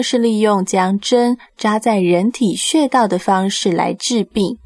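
normal talking voice

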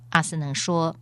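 plain reading voice

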